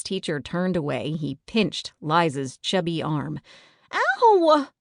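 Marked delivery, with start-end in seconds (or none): 3.9-4.7 high, loud yelp of pain; a sudden shrill ow, much higher and louder; screech. Acted. Pinched girl yelping out in class, high loud screech. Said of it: "Liza screeched".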